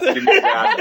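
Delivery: high, loud laughing hiss; beg-demand like a frozen teacher; desperate demand. Retold or imitated. Cold teacher demanding the hidden gasoline, high loud laughing demand.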